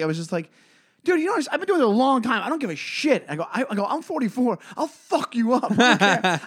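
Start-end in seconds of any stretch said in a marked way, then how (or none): 1.0-3.2 high-pitched, rapid angry rant; worked-up retort, not a full scream; retold screaming. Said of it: "I just screamed at this dude". 3.9-6.4 high-pitched angry threat; worked-up outburst ending in a laugh; retold screaming. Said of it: "I just screamed at this dude".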